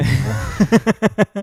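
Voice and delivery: laughing through the punchline; cracking up mid-sentence. Real, not acted. Joke about [name] dead.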